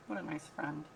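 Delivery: ordinary talk, nothing marked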